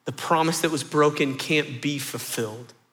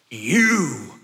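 put-on "liar" screamed far higher and louder in a kid's rage; furious yell; 7 semitones higher, 7 dB louder than usual. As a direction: scream it at your dad with pure venom. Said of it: "yells at the top of his lung with all the venom and rage".

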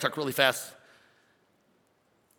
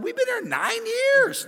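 much higher, slow, offended; indignant member; slower than usual. Retold or imitated. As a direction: stretch it out, hurt and amazed. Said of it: "They'd be like".

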